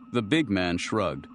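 ordinary delivery

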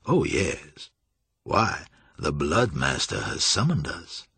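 slow, mildly mocking relish; sardonic Julian. A narrator's reading. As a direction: draw it out with mock deference. Julian sardonically accepts the summons.

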